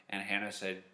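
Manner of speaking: usual speaking voice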